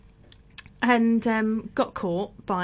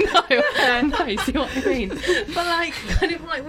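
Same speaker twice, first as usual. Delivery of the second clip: laughing; 8 semitones higher, slower than usual. Real, not acted. Answering the prison joke, real laughter, pitched high. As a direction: laugh it off, voice jumping up.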